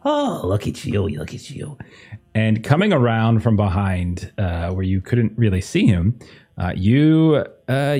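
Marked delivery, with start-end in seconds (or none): 0.1-1.8 cackling voice. Acted. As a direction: taunt him like a gleeful captor. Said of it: "you hear a cackling voice".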